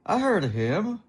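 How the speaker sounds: put-on eager young guy. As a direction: brag it like a pleased kid. Young guy proud, pleased.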